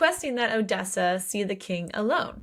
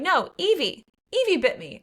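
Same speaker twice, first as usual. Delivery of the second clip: higher-pitched mock indignation; insistent complaint; 7 semitones higher, 3 dB louder than usual. Character corrects whoever misunderstood who bit her, indignant.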